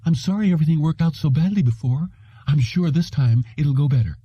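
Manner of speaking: high, flustered apology; nervous, eager to please; fluttery receptionist voice. Acted.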